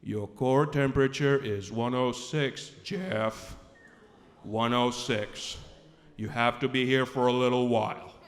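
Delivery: imitated grave male doctor. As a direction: deliver the news in a deep, deliberate monotone. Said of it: "the guy doctor says".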